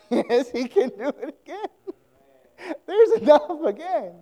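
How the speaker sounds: overwhelmed, half laughing half crying; laughing with joy. Retold or imitated. Widow tells her son it happened again, laughing tearfully.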